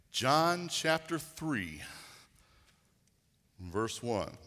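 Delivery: very slow, drawn-out scripture reference; slow repeat. Shown as a demonstration. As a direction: spell out "John chapter three" slowly for the congregation. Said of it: "turn over to John chapter three".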